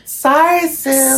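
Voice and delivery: high, sing-song sorry; mock-sorry in a high voice; sing-song apology.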